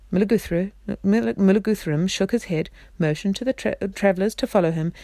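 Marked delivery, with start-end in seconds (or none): none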